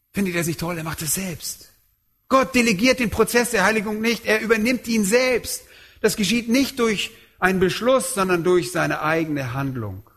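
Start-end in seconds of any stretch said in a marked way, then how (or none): none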